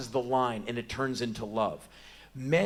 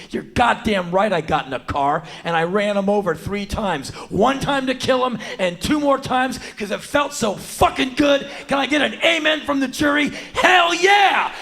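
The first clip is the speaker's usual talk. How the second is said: building from loud to a high shout; defiant triumphant shout; 11 semitones higher, 9 dB louder than usual. Acted. Wife confessing to the jury, rising to a triumphant high yell. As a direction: whip up the jury like a preacher. Clara's imagined defiant testimony.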